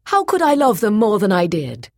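high, loud, impassioned protest; passionate. A narrator's reading. Boyce answering the charge he never returned love, passionate. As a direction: defend yourself with passion. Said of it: "he said passionately".

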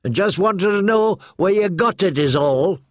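put-on high-pitched defensive boy's protest; Hot Pie backing off, high and defensive. A narrator's reading. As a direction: raise your voice high, hands up, I didn't accuse you. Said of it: "raised his hands defensively".

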